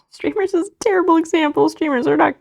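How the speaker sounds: laughing loudly, high and loud. Real, not acted. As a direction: burst out laughing while protesting. Laughing at chat's bad example.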